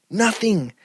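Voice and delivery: ordinary talk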